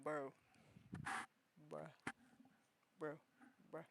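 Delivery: low, flat lazy bro; F-boy voice. Pretend. Streamer showing her F-boy voice, low and lazy. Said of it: "My F-boy voice is this".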